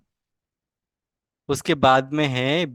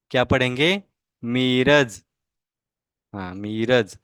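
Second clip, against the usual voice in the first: ordinary talk, nothing marked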